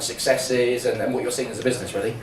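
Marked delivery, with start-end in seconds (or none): none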